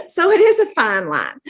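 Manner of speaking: high, loud words coming out of a laugh. Real, not acted. Dietitian amused by a friend's picky son, laughing. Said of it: "I'm laughing".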